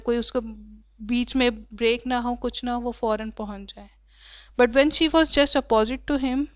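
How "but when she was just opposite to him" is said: reading the passage very slowly and clearly; slow story reading. A narrator's reading. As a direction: read each word out slowly for the class. (Class کے لیے بہت آہستہ پڑھنا)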